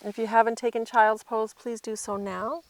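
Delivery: ordinary delivery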